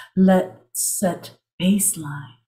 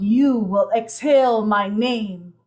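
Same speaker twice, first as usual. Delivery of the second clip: ordinary talk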